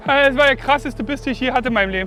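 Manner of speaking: loud, high excited yelling; excited shouting. Real, not acted. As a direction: shout with thrill after a huge bite. Huge bite, fish nearly lost.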